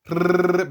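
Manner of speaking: long trilled "rrrr"; rolled R. Shown as a demonstration. Teacher demonstrating the RH digraph, rolled R. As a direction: roll the tongue continuously on "rrrr". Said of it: "by rolling your tongue".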